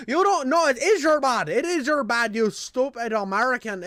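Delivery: high, loud mock Irish scolding; hammed-up furious Irishman; going off. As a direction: imitate an Irishman laying into someone. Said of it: "dude goes off on me".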